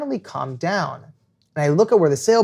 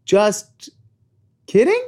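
nervous laughing; 4 semitones higher, slower than usual. Retold or imitated. Nervous retort on game show.

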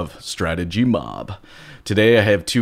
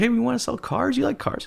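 eager pitch voice; 4 dB quieter than usual. Retold or imitated.

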